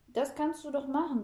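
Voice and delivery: high, eager exclamation; calling out eagerly. A narrator's reading. Girl persuading grandpa to play, calling out eagerly. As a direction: jump in keenly to persuade. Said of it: "ruft Fräulein Schmalzbrot".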